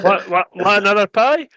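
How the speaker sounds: high, loud mock heckle; mock heckler. Grumpy spectator jeers at an unfit player, high and loud.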